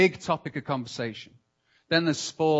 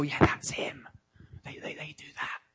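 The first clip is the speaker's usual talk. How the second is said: whispered finger-pointing gossip; mock whisper; 6 dB quieter than usual. Preacher acting out churchgoers pointing at someone, whispered. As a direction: whisper it like a sly accusation. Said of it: "point at anybody and go".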